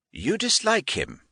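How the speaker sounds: lightly voiced, stern English lord; irascible old lord. A narrator's reading. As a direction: lay down the law to a nephew. Narrator voices Lord Grey.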